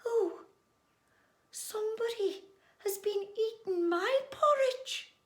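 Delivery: very high, gentle character voice; dainty high-pitched voice, held back; quiet Mother Bear. Acted. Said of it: "in a quiet voice".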